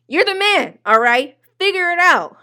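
high, loud mock hype-man voice; a hammed-up hype speech to a clueless man; mocking pep talk. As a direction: pump him up in a sarcastic, high, loud voice. Mocking the date's advice.

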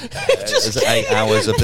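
slow, high laughing words. Real, not acted. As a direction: laugh through it. Laughing while telling.

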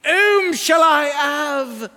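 loud, high Cockney wail to heaven; hammed-up Cockney plea, shouted; thick Cockney cry. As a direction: cry out slowly in broad Cockney. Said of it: "cries out to the heavens in that thick Cockney accent".

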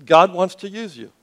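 ordinary talk, nothing marked

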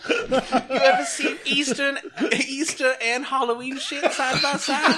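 high, laughing store mascot pitch; chirpy ad mascot, giggling; bright squeaky sing-song welcome. Pretend. Walgreens mascot ad voice.

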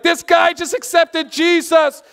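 high, loud call across the street; excited yell. Retold or imitated. Preacher yelling to his team across the park, excited. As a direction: shout the good news over to the team. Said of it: "I literally yell over to the team".

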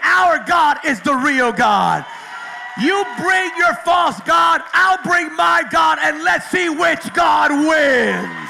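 slow, loud challenge to the false god; defiant shout. Retold or imitated. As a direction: throw down the gauntlet, loud and slow.

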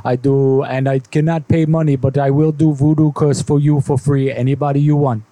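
thick put-on foreign accent; heavy fake accent, broken English. Foreign voodoo businessman voice.

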